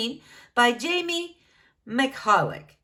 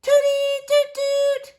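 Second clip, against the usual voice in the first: high, loud trumpet imitation; cartoon trumpet blast; trumpet toots; 7 dB louder than usual. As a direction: toot like a kid's trumpet. Bean plays trumpet loudly.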